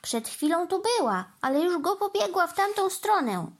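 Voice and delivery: high-pitched mild shout; calling after children. A narrator's reading. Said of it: "krzyknął za dziećmi Trevor".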